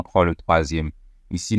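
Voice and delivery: ordinary talk, nothing marked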